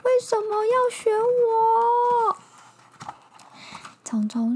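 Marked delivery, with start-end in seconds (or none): 0.0-2.4 high, loud whiny bear; frowning angry bear. Acted. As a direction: complain like a grumpy bear. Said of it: "皱着眉头说".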